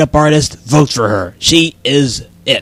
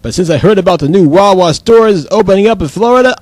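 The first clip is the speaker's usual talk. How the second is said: ordinary delivery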